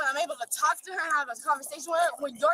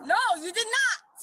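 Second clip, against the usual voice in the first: slow, raised and emphatic; 4 semitones higher, slower than usual. Real, not acted. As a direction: push back with a raised voice. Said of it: "There's no need for you to raise your voice".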